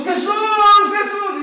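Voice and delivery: high, stretched calling of a name; shouted call. Acted. Believers call without saying what they want, crying out. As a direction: keep calling, high and insistent. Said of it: "podemos estarle gritando a Jesús".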